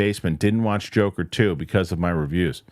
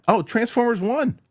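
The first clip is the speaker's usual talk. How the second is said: sudden recall. Real, not acted. Excited pick after searching memory.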